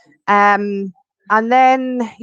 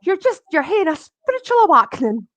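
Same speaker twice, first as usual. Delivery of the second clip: calm her down like a kind dowser; reassuring gentleman; 9 semitones higher, 3 dB louder than usual. Retold or imitated. The dowser reassuring her at his work, higher and soothing. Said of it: "he went".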